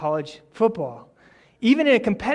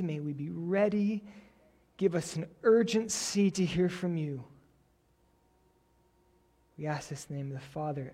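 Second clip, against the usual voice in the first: prayer; 2 semitones lower, 5 dB quieter than usual. Acted. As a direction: pray it quietly and unhurriedly, pausing between phrases. Leads the opening prayer.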